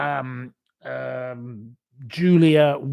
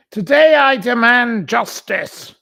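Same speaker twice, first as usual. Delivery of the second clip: higher and louder, mock-grand; mock demand; 8 semitones higher, 9 dB louder than usual. Mocking the prisoner's demand to viewers, loud and pompous. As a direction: declaim it like a pompous protester. Reading her words mockingly.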